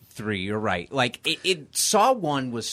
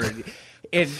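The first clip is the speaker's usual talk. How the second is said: ordinary delivery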